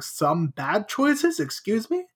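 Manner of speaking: slow, disbelieving echo, lightly acted; mock incredulity. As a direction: stretch it out as a playful indignant challenge. Sadie's retold outraged echo.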